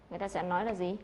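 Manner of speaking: ordinary talk, nothing marked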